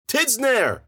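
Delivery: high, loud name call; searching shout. A narrator's reading. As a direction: yell the name into the dust. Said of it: "he called".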